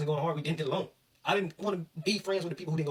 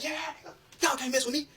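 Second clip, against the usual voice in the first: cocky taunt; 13 semitones higher than usual. Retold or imitated. Player taunting receivers he is locking down, cocky. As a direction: crow it like nobody can touch you. Retold taunt while dominating opponents.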